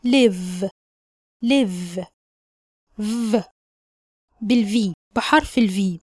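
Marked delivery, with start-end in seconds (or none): 0.0-2.0 live with a short vowel and a clear v. Shown as a demonstration. Teacher modelling live for learners. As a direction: end live on a voiced v. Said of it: "بننطقها بالشكل ده".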